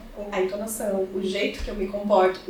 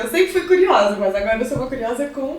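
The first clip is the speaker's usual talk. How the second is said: excited; 4 semitones higher, 7 dB louder than usual. Real, not acted. Proudly jokes about philosophy course.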